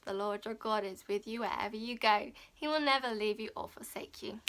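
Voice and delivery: usual speaking voice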